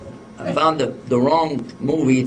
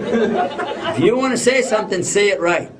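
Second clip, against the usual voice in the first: imitated scolding, higher and drawn out; demanding; 6 semitones higher than usual. Lady demanding a proper proposal, mock scolding. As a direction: lay down the law to a fumbling suitor.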